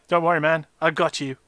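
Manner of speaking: loud robotic voice; booming voice-changer robot. Retold or imitated. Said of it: "really robotic voices".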